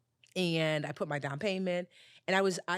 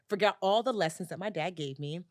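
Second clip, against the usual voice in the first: usual speaking voice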